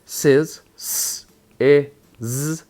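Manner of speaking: "Sizz" whole, then "S, I, Z" as separate sounds; sounded out. Shown as a demonstration. Teacher gives each letter its sound, one by one. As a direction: split "Sizz" into hiss, short i, buzz. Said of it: "So each one letter".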